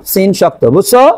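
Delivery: ordinary talk, nothing marked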